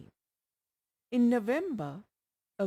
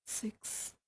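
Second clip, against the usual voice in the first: near-whispered, barely any voice; weak patient's faint whisper from her bed; very quietly; 8 dB quieter than usual. Acted. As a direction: breathe the word out faintly, almost unvoiced. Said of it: "very quietly".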